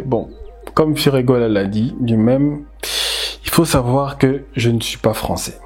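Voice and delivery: ordinary talk, nothing marked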